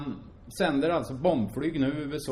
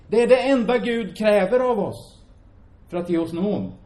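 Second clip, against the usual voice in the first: louder and higher, ringing; proclaiming preacher; 7 semitones higher, 7 dB louder than usual. Acted. Preacher telling the congregation what God asks, ringing and loud. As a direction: proclaim it to the whole church.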